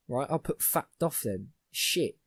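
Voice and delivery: ordinary talk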